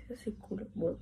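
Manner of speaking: unvoiced whispered aside; whispering. Real, not acted. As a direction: breathe it out in a whisper. Whispered aside about the grains.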